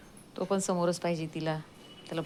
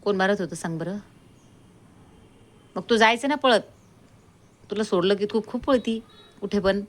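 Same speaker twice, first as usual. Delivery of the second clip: a touch higher, teasing telling-off of the dog; lightly raised teasing pet voice; mock scolding pet talk; 4 semitones higher, 6 dB louder than usual. Real, not acted.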